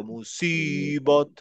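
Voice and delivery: মুসিবত very slow, lips rounded on the স; slow careful মুসিবত, rounded স; round-mouthed musibat. Shown as a demonstration. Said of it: "মুখটা গোল লাগবে".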